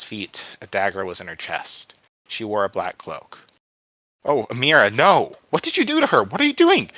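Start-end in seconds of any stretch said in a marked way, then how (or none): 4.2-6.9 climbing from loud cry to high scream; horrified screaming. A narrator's reading. Boy seeing his friend stabbed, horrified scream. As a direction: cry out in horror at what they did.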